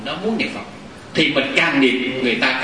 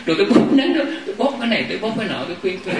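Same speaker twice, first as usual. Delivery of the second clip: humoring the patient; 9 semitones higher than usual. Retold or imitated. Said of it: "Thì nói".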